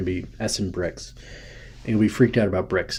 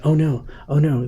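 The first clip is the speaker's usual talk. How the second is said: higher, louder feigned dismay; mock alarm; 5 semitones higher, 6 dB louder than usual. Acting out fretting over upset Saudi Arabians, mock alarm. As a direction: play at being worried, hammed-up.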